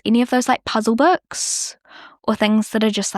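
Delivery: ordinary delivery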